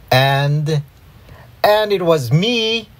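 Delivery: high, drawn-out interrupting call; yelled. A narrator's reading. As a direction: burst in high to take the blame. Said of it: "yelled A J".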